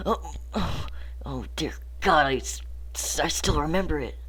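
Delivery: halting, stammering horrified recollection; acted dread. Narrator acts overcome by horrific memory.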